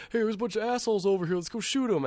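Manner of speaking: high, excited call to the squad; rally the team to go shoot; teammate calling out. Retold or imitated. Player calling teammates, high and excited. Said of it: "people are like".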